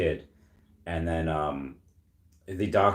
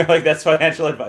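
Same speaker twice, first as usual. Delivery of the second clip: laughing; 8 semitones higher, 7 dB louder than usual. Real, not acted. Laughing at own joke.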